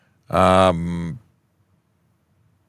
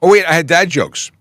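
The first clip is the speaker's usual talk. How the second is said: suddenly higher and louder, caught off guard; sudden remembering; 12 semitones higher, 9 dB louder than usual. Real, not acted. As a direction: blurt it out as it hits you. Host suddenly remembers dad jokes.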